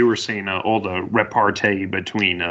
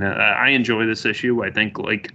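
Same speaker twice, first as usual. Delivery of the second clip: normal talking voice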